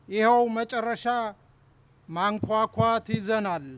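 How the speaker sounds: measured, declaimed Amharic poem reading; poetry recital. Acted. Poet reading his poem aloud to the audience, declaimed. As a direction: give each line its weight, pausing between phrases.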